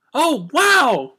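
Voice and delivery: loud, high gasp of wonder; amazed, breath caught; gasped wow. A narrator's reading. Pinkie to Twilight after the magic, high gasping wow. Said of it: "Pinkie gasped".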